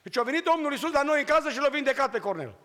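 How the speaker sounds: high, loud excited call; little brother shouting the healing news; child calling out. Retold or imitated. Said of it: "strigă".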